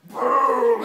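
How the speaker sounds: loud, high explosive boom; imitated explosion. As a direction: blast it out like a fireball.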